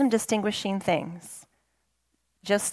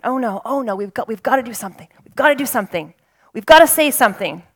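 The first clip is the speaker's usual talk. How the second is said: mock panic, getting louder and faster; panicked oh no; 3 semitones higher, 7 dB louder, much faster than usual. Preacher mimicking panicked people, rising to a shout. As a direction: act out frantic people rushing around.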